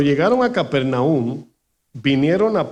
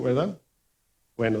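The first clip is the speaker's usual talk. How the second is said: usual speaking voice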